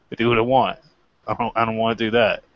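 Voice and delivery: ordinary delivery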